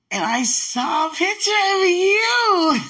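high, loud, drawn-out squeal of delight; shrieking with delighted surprise; excited squeal. Real, not acted. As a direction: squeal it out in joyful disbelief. Excited outburst on seeing photo.

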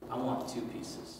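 put-on soft, breathless little-kid ask; a light imitation of a child bargaining; hushed mock child's plea; small child wheedling. Child bargaining for candy retold.

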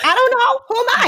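mock bewilderment. Joking with friends, mock clueless high voice. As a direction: play up being clueless.

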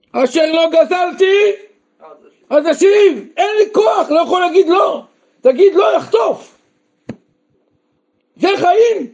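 preacher at full force; fiery preaching. Acted. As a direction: drive the point home with fire.

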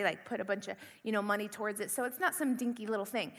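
normal talking voice

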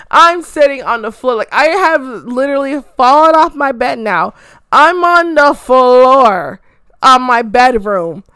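loud, stretched-out mock distress; dramatic overwhelm. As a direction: wail it like a hammed-up fan meltdown. Retells sobbing overreaction on the floor.

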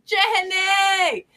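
a high, loud call across the room. Real, not acted. Shouting across the shop to greet Jenny. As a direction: hail her from a distance. Calling out to a friend.

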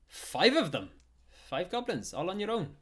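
high, loud astonishment; amazed. Acted. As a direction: exclaim like an impressed blacksmith. Blacksmith amazed at goblin ears.

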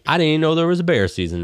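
high, rapid mock excuse; mocked clueless newcomer. Storyteller repeats newcomer's excuse, mocking high voice.

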